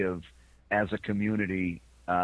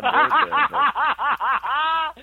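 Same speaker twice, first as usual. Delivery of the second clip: words breaking into a high laugh; 14 semitones higher, 11 dB louder, much slower than usual. Real, not acted. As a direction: laugh your way through it, much higher and louder. Laughing while talking.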